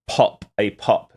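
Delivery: slow, deliberate "pop" with a clear open o; careful pronunciation. Shown as a demonstration. Streamer correcting chat, slowly saying "pop". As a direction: spell out "pop" slowly, not "pocket". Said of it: "Sorry, not, not pocket".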